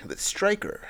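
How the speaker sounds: big booming ring announcer. Acted. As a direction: hype it like a fight-night intro. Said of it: "that's announcer voice".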